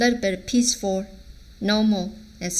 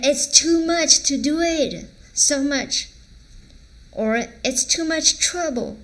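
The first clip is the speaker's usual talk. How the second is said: exasperated; 6 semitones higher than usual. Shown as a demonstration. Acting out the exasperated intonation.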